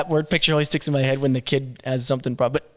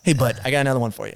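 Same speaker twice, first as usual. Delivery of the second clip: quick cheerful dad; much faster than usual. Retold or imitated. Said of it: "And then I go".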